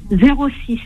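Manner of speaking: normal talking voice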